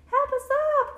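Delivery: high, loud plea; crying out for help. A narrator's reading. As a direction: call up from the pile in a high voice. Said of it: "cried A, B".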